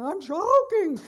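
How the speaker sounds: high, loud strangled I'm choking; mock choking cry. Preacher reenacting choking in dental chair, loud mock cry. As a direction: ham up being choked, laughing.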